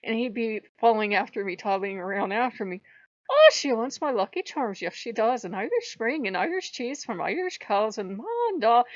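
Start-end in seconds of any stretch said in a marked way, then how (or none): 3.3-8.8 lilting, teasing stage-Irish brogue; mock Irish accent. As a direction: put on a jokey Irish accent, swooping up and down. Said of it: "Lou would start it because he thinks it's hilarious".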